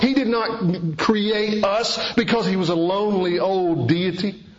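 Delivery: rising, high-pitched sermon climax; preaching. Acted. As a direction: build the preaching up and up. Preaching at full force.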